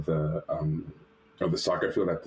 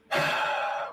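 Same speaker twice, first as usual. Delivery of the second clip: long breathy exhale; awkward, stalling breath out; reluctant sigh. Retold or imitated. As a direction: sigh heavily before answering. Stalling before awkward interview answer.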